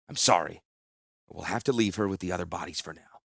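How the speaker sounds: low, soft, sorry; gentle consolation. Acted. Consoling grieving sailor.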